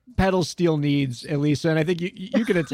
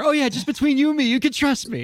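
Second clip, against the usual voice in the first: high, quick slippery reassurance; mock reassuring lawyer; 9 semitones higher than usual. Lawyer winning a client's trust, mock reassurance. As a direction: play the too-eager lawyer, pitched up. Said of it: "been told that by a lawyer".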